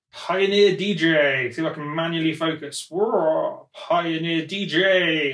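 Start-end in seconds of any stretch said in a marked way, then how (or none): 0.1-1.5 slow announcement. Acted. Announcing the unboxed gear. 3.7-5.3 slow announcement. Acted. Announcing the gear again.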